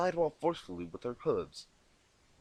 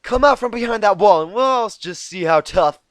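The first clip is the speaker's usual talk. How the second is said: loud challenge; 8 semitones higher, 16 dB louder than usual. Acted. Challenging from behind wall.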